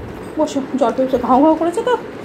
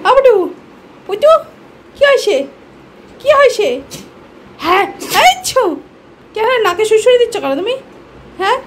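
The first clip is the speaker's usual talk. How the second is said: high, cutesy questioning; playful pet talk; 9 semitones higher, 3 dB louder than usual. Real, not acted. Asking the pet about its tickled nose, playful baby talk.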